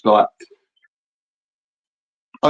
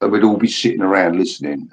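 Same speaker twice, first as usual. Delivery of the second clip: ordinary delivery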